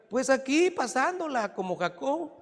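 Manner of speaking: high-pitched mock reply to God; sheepish answer. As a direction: lightly play someone excusing himself. Acts out a person answering God.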